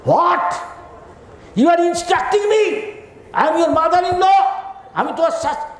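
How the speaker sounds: high, outraged mother-in-law; shrill disbelief, how dare you instruct me; indignant scolding. Retold or imitated.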